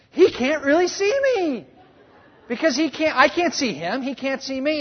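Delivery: put-on high, light little-girl voice, giggly; child's voice. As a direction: play the three-year-old hiding behind the couch. Voicing giggling three-year-old daughter.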